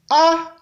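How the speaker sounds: A held on a high, level tone. Shown as a demonstration. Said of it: "Ton haut". (« A » au ton haut)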